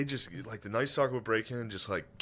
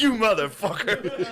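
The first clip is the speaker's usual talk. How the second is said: high, loud, drawn-out curse through laughter; slow mock angry curse; 7 semitones higher, 5 dB louder than usual. Shot husband cursing the intruder, slow laughing curse. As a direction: spit it out slowly, half laughing. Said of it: "and be like".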